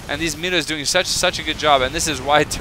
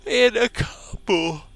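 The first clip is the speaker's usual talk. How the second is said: words stretched by a yawn; 9 semitones higher, much slower than usual. Real, not acted. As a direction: talk through a big yawn. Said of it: "I don't know why I'm yawning so much".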